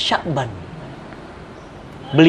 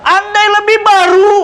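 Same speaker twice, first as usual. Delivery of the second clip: higher, slow, drawn-out cry; dying cry; 10 semitones higher than usual. Acted. Dying man cries his regret, high and slow. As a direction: lift and stretch the regret. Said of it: "Sya'ban bilang apa".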